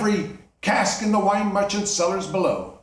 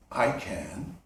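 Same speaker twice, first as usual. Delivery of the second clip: low, much softer ghostly answer; solemn ghost; 10 semitones lower, 6 dB quieter than usual. Acted. Marley's ghost answering Scrooge, low and hushed. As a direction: answer from the grave, hushed and heavy.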